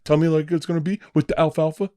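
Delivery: a hint of Lenny begging to hear about the rabbits. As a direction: ask George like a hopeful child. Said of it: "I was like Lenny".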